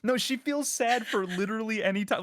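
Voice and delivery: high, loud amused protest; animated teasing. Real, not acted. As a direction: argue it loudly, on the edge of laughter. Laughing, teasing a partner's sadness.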